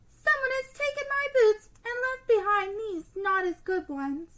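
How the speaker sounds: high, loud cub voice; baby bear. Acted. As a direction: whine like a little bear cub. Baby bear loses boots.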